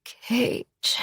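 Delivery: soft breathy moan of his name. Acted. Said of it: "I moaned".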